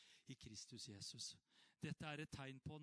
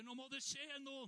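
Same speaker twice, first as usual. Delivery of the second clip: urgent cry; 10 semitones higher than usual. Retold or imitated. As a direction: cry out that something must happen now. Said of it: "alle roper".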